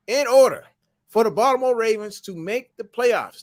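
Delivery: loud, high opening of the big reveal; dramatic announcement. Real, not acted. Building up the playoff math for Hassan, loud and high. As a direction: announce it like a hype man.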